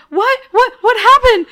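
high, loud alarmed questioning; jolted awake and panicking; startled questions. Retold or imitated.

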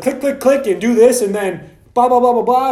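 mock the lazy routine treatment with a sneer; fired-up mockery. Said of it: "So you know I'm fired up".

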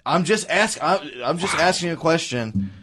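hammed-up wounded retort; mock defensive. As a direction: protest like a hurt partner in a squabble. Woman retorting in retold quarrel.

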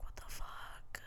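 hushed, whispered disbelief; stunned whisper. Retold or imitated. Acted stunned reaction to healed wound.

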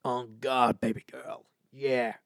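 mock Texan American drawl. British host imitating Americans from Texas and Houston, mock Texan accent. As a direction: put on a light Texas accent. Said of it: "the Americans from, like, Texas and Houston".

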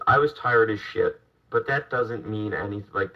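ordinary talk, nothing marked

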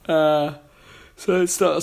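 yawning mid-sentence. Real, not acted. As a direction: talk through a yawn. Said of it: "sorry, I'm yawning".

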